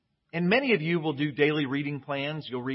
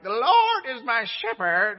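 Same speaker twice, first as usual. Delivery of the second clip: slow, higher and louder mock-British scripture reading; 8 semitones higher, 7 dB louder, slower than usual. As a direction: ham up an old-fashioned British reader intoning the psalm. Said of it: "it was the older voice reading it ... sort of British".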